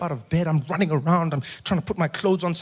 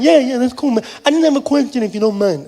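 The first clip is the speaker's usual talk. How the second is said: higher voice imitating a deaf man's accent; partially deaf speech; 6 semitones higher, 4 dB louder than usual. Fan admits he's partially deaf, higher deaf-accented voice. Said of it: "thought you had an accent".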